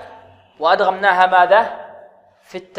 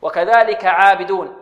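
normal talking voice

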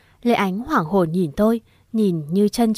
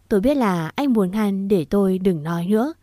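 ordinary delivery

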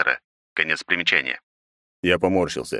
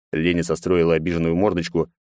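usual speaking voice

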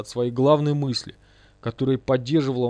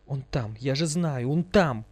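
crying out; slower than usual. A narrator's reading. Лиза gropes in the wall for gold, crying out. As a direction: insist desperately, lifting the last words. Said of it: "кричала Лиза".

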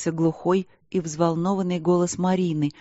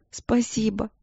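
sniffling thanks; 5 semitones higher, slower than usual. Acted. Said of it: "шмыгала носом".

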